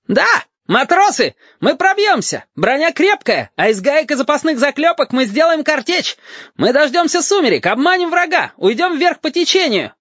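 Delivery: very high, frantic exhortation; rally them in a screechy high voice; shrill rallying cry. A narrator's reading. Lieutenant urges sailors to break through, shrilly.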